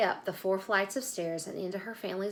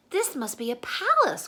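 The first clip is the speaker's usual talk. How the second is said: awed gasp; 8 semitones higher, 6 dB louder than usual. Acted. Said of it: "Corduroy gasped".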